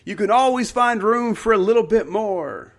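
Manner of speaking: high, loud, exuberant shout; excited, high-pitched eager answer; energized student. Retold or imitated. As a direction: blurt the answer with proud excitement. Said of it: "really getting energized and feeling good".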